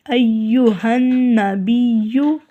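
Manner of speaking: slow ایوہن نبیو with ghunna and doubled ya; slow full phrase. Shown as a demonstration. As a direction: model ایوہن نبیو carefully. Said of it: "تو غنہ بھی کریں گے". (آہستہ ایوہن نبیو)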